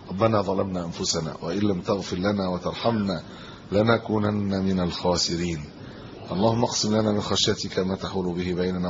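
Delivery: low, even, measured prayer voice; closing supplication. Real, not acted. Preacher closing his sermon with prayers to God, subdued supplication. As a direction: pray in a subdued, steady cadence, each phrase falling at the end.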